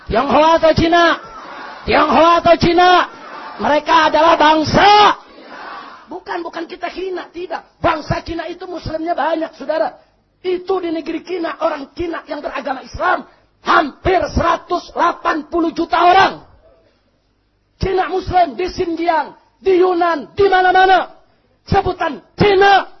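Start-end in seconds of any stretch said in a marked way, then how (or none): 0.1-5.2 loud rally call-and-response; full-force oratory. Real, not acted. 10.4-22.9 high, increasingly loud rally speech; full-force oratory. Real, not acted.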